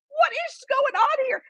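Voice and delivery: high-pitched, incredulous squeal of a question; retold disbelief. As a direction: replay being utterly flabbergasted. Retelling her own disbelief.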